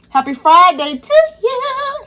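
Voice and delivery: high, slow, drawn-out Friday cheer; excited cheer. Real, not acted. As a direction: sing out the greeting. Celebrating Friday with viewers.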